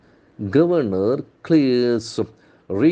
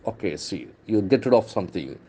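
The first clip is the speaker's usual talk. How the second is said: normal talking voice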